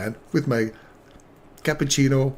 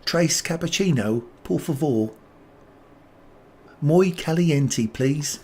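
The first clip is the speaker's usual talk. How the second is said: Spanish said in a mock English accent, tres and por favor anglicised; imitating mom's English-accented Spanish; lightly English-accented tourist Spanish; 2 semitones higher, slower than usual. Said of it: "the little Spanish with an English accent".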